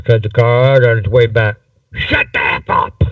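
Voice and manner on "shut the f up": harsh unvoiced hiss at the prisoners; hissed whisper. A narrator's reading. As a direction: spit it out in a breathy whisper. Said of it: "he hissed".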